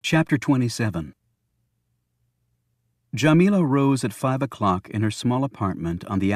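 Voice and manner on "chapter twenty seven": plain reading voice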